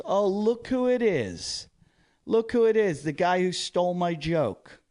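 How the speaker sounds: high, drawn-out sarcastic call-out; mocking accusation. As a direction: jeer at him for the whole table to hear. Drunk comic publicly accusing him.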